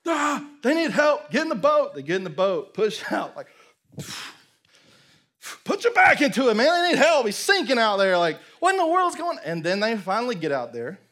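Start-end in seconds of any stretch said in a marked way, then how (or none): 0.0-2.0 high, loud frantic call; rally helpers to the boat; urgent calling out. Acted. Acting out cries for help. 5.6-8.2 high, loud frantic exhortation; urgent yelling. Acted. Fishermen urging each other to row. 8.6-9.4 high, loud mock alarm; bewildered outcry. Acting out bewildered fishermen.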